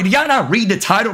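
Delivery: ordinary talk, nothing marked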